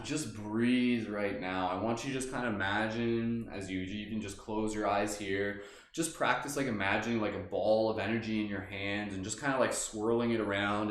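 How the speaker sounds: guided meditation. Acted. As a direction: guide them gently inward. Leads a meditation.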